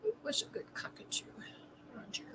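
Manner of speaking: soft breathy whisper. Real, not acted. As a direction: whisper it like a farewell. Echoing the eulogy softly.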